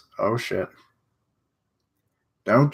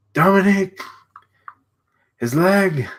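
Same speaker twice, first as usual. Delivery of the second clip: loud, high call of a name; 8 semitones higher, 4 dB louder than usual. Acted. Calling out to wounded Dominique, loud and high. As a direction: shout the name across the field.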